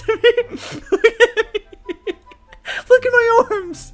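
high, squealing through laughter; cracking up, barely getting the words out; helpless laughing. Real, not acted. Cracking up at avatar's arms.

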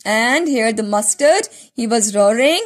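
ordinary delivery

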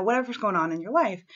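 ordinary talk, nothing marked

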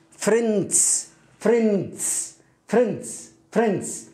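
فريندز as one syllable, ending in a plain s sound; correct pronunciation. Shown as a demonstration. Teacher modelling the correct plural for learners, careful pronunciation. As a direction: repeat فريندز with the final s blended straight on. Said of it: "تنطقه مثل السين".

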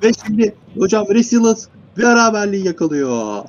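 excited goal call. Real, not acted. Commentator announces the equaliser to co-casters, excited goal call.

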